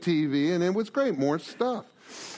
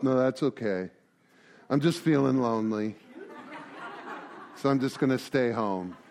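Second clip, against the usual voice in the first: lower, softer, self-pitying sigh of a no; mopey refusal; 6 semitones lower, 4 dB quieter than usual. Acted. Lonely man turns down the dinner invite, low and mopey. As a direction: sink into it, wallowing alone.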